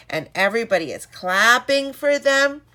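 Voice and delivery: ordinary delivery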